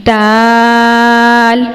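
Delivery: دال with the vowel held long, tongue tip behind the upper teeth; drawn-out letter demo. Shown as a demonstration. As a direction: stretch دال slowly. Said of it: "اس کو بھی ہم نے لمبا کر کے تھوڑا سا پڑھنا ہے". (دال لمبا کر کے)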